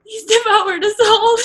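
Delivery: high, loud despairing cry; full-throated wail of despair; anguished scream. Acted. Ghost girl breaking down.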